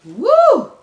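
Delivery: a loud, very high whoop of delight; excited whoop. Real, not acted. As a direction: let out a big happy woo. Delighted by the food.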